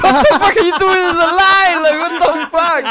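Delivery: high, loud, laughing "what the fuck"; incredulous laughing outburst at the man in line; laughing disbelief. Retold or imitated. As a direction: shriek it out between laughs. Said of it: "I'm like".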